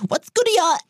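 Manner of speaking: high laughing "what's good" voice; casual greeting impression. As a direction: mimic a breezy slang greeting. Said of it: "alle mit ... begrüßt".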